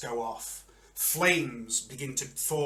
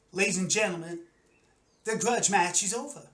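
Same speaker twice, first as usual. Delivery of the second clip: higher-pitched fight announcer; arena announcer; 6 semitones higher, 3 dB louder than usual. Acted. Announcer addresses arena crowd.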